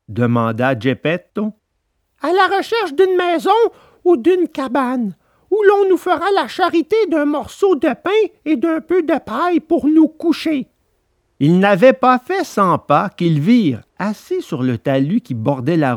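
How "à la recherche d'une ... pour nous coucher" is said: put-on high, gentle boy puppet; Pinocchio voice. A narrator's reading. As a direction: coax his weak father along kindly. Pinocchio answers Geppetto.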